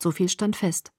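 ordinary delivery

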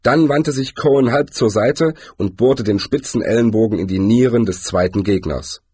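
ordinary delivery, nothing marked

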